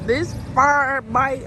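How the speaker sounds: ordinary talk, nothing marked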